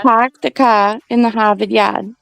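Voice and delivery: Boston "pahk the cah in Hahvahd"; Boston accent. Shown as a demonstration. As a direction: drop the R's, Boston style. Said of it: "keep practicing".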